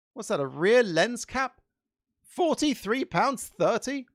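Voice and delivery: incredulous. Real, not acted. Shocked at price.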